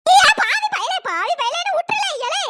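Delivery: put-on an outraged granny wailing at the boy; wailing old granny. Grandmother wailing after grandson mistakenly kisses her.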